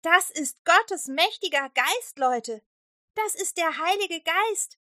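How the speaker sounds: high, loud and slow, full of wonder; excited disciple proclaiming the Spirit; joyful proclamation. Acted. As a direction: announce it like great news.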